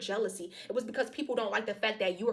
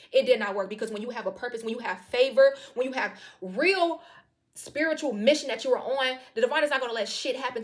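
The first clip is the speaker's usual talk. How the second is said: louder and louder, the words pressed out with emphasis; building preaching; 2 semitones higher, 6 dB louder than usual. Real, not acted. Rising preaching about purpose.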